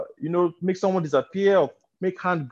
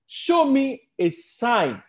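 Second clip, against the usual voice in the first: drawn-out, mild "Show me a sign"; slow demand; 6 semitones higher, much slower than usual. Acted. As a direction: stretch each word of the demand. Quoting the crowd, slowed.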